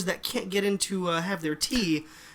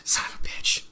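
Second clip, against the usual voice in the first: breathy unvoiced curse under the breath; whispered curse; 4 dB quieter than usual. Real, not acted. Friend hisses at cohost's bionic throat joke, whispered. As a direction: hiss it out, defeated.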